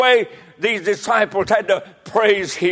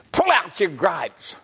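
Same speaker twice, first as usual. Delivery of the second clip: normal talking voice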